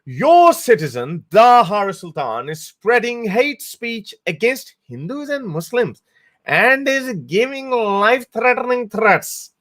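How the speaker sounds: high, slow, sneering read of a troll's tweet; mocking troll voice. Vlogger reading a hateful tweet aloud, in a high mocking voice. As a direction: ham up the accuser like a clueless troll. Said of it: "it goes".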